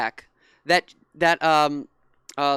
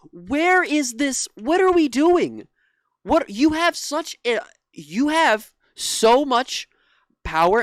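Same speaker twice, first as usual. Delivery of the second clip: higher, louder, drawn-out disbelief; real exasperation, slowed and raised; frustrated rant; 5 semitones higher, 4 dB louder, slower than usual. Real, not acted. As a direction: demand answers from the network. Ranting in frustration at the network.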